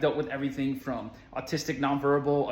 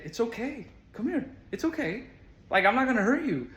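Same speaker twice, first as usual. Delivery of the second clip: put-on high-pitched comforting voice for a child; tender, lifted adult-to-child voice; gentle reassurance; 8 semitones higher than usual. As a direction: coax a scared child closer. Retold comforting of crying child.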